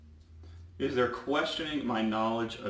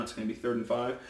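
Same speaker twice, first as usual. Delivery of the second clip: ordinary talk, nothing marked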